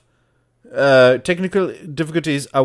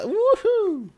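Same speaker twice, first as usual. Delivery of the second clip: high-pitched little whoop; playful cheer. Pretend. Streamer telling what you could do, playful high cheer. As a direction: give a light, high woohoo.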